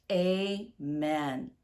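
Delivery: ordinary delivery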